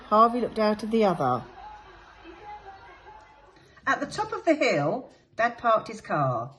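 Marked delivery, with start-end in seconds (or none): none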